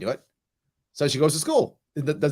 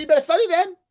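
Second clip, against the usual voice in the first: high, louder teasing dare; mock indifference; 5 dB louder, faster than usual. Dad to daughter who won't study, mock careless. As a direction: dare her, playfully careless. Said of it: "I said".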